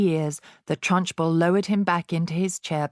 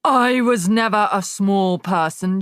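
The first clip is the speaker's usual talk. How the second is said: a sharp, louder snap; snapped; 3 semitones higher, 6 dB louder than usual. A narrator's reading. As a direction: bite the line off at him. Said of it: "she snapped".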